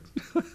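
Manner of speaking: short chortle to oneself; little incredulous laugh; acted chuckle. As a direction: chuckle a bit, privately. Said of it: "chuckling a bit".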